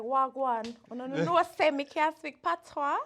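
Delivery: a light Jamaican patois put-on; Jamaican accent. Showing the hosts how she played Jamaican, light Jamaican accent. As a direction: play at a Jamaican accent, half joking. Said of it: "I want you to play Jamaican".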